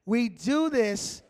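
slow and loud, weight on "do"; "We do this" said slow and loud with "do" leaned on; foundational. Real, not acted. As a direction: lay it down like a foundation. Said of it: "read it like it's foundational".

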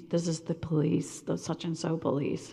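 whispered police announcement at the door. Retold or imitated. Police officer at the door at night, whispered.

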